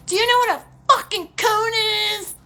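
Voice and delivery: hammed-up condescending cop; mocking the cop. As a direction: sneer it like a smug officer. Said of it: "I'm like".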